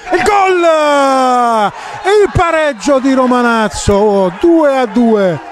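excited goal call. Real, not acted. Goal called in commentary.

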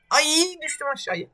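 high-pitched cry of dismay. Real, not acted. Gamer falling off the course, high dismayed cry. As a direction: wail as you fall. Player falls down in game.

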